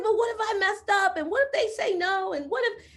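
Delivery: high, rushed what-ifs; worried caller to her coach; anxious worry. Retold or imitated. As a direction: spiral into panic. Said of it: "I was like".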